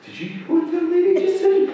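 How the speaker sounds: high, indignant gossip to a friend; indignant aside to friend. Retold or imitated. Volunteer tells her friend about the sushi boss, high mock outrage. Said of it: "you say to your friend".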